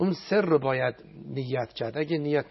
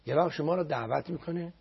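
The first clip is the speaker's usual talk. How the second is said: ordinary delivery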